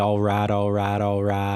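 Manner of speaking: lazy drawled McConaughey catchphrase; Matthew McConaughey. Retold or imitated. Comparing the South to McConaughey, laid-back drawl. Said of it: "we're more Matthew McConaughey".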